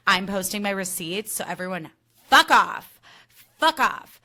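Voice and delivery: put-on bratty, loud girly snap; mock petty girly pop. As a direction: spit out the fuck off like a petty diva. Quoting Jesse, girly pop.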